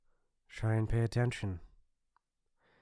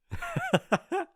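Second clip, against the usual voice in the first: high derisive giggle; mocking laugh. Bully being called a bully, high mocking laugh. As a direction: laugh off the accusation.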